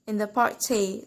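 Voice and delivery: ordinary talk, nothing marked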